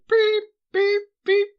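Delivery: high, loud beeping noises; reversing truck beeps. Retold or imitated. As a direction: beep like a truck backing up. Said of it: "a truck backing up noise".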